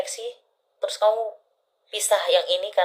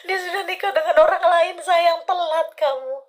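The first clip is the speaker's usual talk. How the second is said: high laughing tease; giggly teasing through laughter; 11 semitones higher, 4 dB louder than usual. Real, not acted. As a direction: laugh through the words, much higher. Laughing while teasing client.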